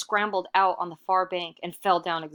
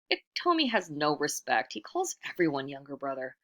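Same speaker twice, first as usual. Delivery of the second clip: usual speaking voice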